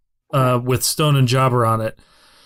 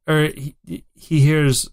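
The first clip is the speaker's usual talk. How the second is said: usual speaking voice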